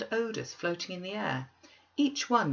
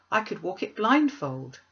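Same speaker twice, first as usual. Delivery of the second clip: louder, eager exclamation; with fervour; 3 semitones higher, 7 dB louder than usual. A narrator's reading. Scrooge answering the ghost about his old road, fervent and louder. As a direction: burst out with it, fervent and proud. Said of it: "cried Scrooge with fervour".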